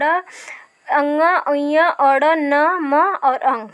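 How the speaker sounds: each nasal sound drawn out slowly, humming through the nose; nasal consonants spelled out. Shown as a demonstration. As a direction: sound out अंग, उय, अड़, न, म slowly and nasally, one by one. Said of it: "इसकी संख्या कितनी होती है?".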